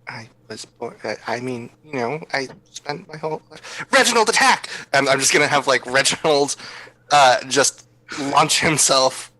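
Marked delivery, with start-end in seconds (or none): 0.1-3.8 very soft, halting excuse; evasive mumble. Acted. Child character stalls before attack. 3.9-4.7 sudden high call to attack; attack command. Acted. Player calls pet to attack.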